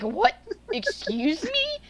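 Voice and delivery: high, slow incredulous "Excuse me?"; retelling her reply to the podiatrist, high and slow with disbelief; shocked disbelief. Retold or imitated. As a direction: stretch it out in stunned disbelief. Shocked at surgery right now.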